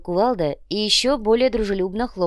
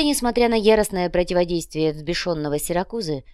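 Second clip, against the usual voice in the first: usual speaking voice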